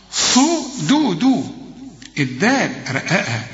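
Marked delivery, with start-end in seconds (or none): 0.1-1.1 slow high صدو with the dal thickened; mock wrong. Shown as a demonstration. Teacher mocks the wrong reading, high drawn out. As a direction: stretch صدو high and heavy, as the error. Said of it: "لا يا راجل".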